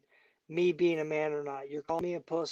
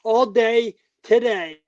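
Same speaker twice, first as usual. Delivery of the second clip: loud, higher, fired-up rant; angry loud raised rant; heated rant; 3 semitones higher, 8 dB louder than usual. Real, not acted. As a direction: vent it hard at the room. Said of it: "most heated guest ever".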